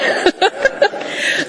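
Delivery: breathy high laugh at the chair; a quick amused laugh; short laugh. Real, not acted. Answering the chair's not quite with an amused laugh.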